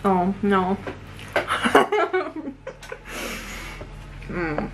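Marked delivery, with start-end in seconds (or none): 0.8-4.2 airy helpless giggle; breathless laugh. Real, not acted. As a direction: laugh quietly in disbelief. Reacts to the dense crust.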